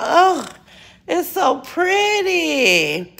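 high, slow gushing; exaggerated delight. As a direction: gush over it, drawn out. Showing off her stairs.

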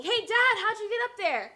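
put-on high, loud child calling out to her dad; child yelling up. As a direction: call up to the balcony as an excited little kid. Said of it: "yelling up to my dad on the balcony".

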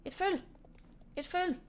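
a gasp, then a high happy cry; delighted to spot the foal; excited. Real, not acted. Spots a foal, gasps.

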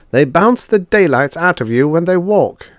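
loud, high-pitched grumble; grumbling. A narrator's reading. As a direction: complain about the rough ride. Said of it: "Drummond grumbled".

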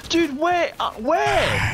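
high, loud urgent calling; high panicked shout. Real, not acted. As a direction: yell out for the sniper's position. Shouting for sniper location.

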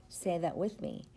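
ordinary talk

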